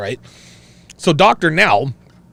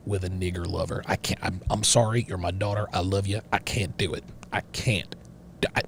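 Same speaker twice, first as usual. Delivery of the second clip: low, hushed father delivering grave news; disappointed father; 8 semitones lower, 14 dB quieter than usual. Acted. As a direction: speak softly and low, as a father rejecting his daughter. Acts a father disowning daughter.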